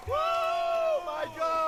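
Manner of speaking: high thrilled yell; excited shout. Real, not acted. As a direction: shout it out in awe. Hyped reacting on stream.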